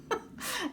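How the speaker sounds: quick bright burst of laughter; short high laugh. Real, not acted. Laughs at her own reading.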